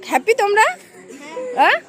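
bright, loud cheerful question; excited. Real, not acted. Asking the kids after results.

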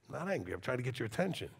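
soft, low reassurance; sudden calm. Shown as a demonstration. Shifting gears after confronting someone, soft and calm. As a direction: drop straight down to a gentle, quiet voice. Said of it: "I'll go from that to this".

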